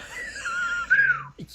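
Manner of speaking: high shrieking burst of laughter. Real, not acted. Host reacting to the truck sum, shrieking laugh. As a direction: let a laugh squeal up high. Shocked by 350 million.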